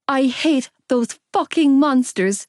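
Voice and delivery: slow, high, choked fury; seething through tears; bitter anger. A narrator's reading. Bit back a roar.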